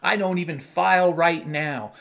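ordinary talk, nothing marked

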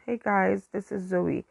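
usual speaking voice

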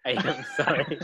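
laughing through an apology; cracking up mid-line. Real, not acted.

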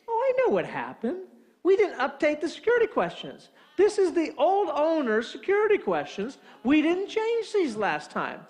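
high-pitched, exasperated discovery; dawning realization. Retold or imitated. Homeowner realises whose questions these are, exasperated realization. Sudden realization, acted inner voice.

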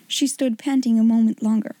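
usual speaking voice

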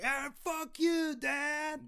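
very high, slow anguished cry; pained yell. Retold or imitated. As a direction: scream it out in pain. Brother breaks, yells at stepdad.

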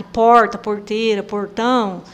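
interior São Paulo accent with retroflex R; "porta, porteira, portão" with a heavy caipira R; caipira pulled R. Shown as a demonstration. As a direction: pull the R like rural São Paulo. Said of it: "esse R puxado".

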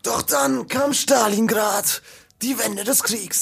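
langsam und schicksalsschwer erzählt; Doku-Sprecherstimme. Retold or imitated. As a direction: wie ein dramatischer Geschichtsdoku-Erzähler. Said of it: "diese Dokus gesprochen hat". (spielt mit beim Nachahmen des Doku-Sprechers)

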